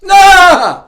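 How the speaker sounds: high loud blurted cry; yelled. A narrator's reading. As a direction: belt it out again. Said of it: "Frosty yelled again".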